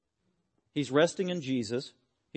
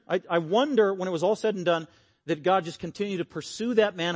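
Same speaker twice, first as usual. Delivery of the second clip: ordinary talk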